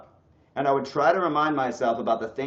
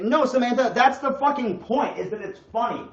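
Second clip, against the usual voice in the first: loud, pitched-up exasperation; exasperated outburst; 11 semitones higher, 5 dB louder than usual. Real, not acted. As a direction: fire back at the chatter. Answering chat member Samantha.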